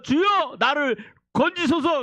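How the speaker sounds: high loud cry for rescue; desperate cry. Acted. Peter sinking in the sea, high desperate cry.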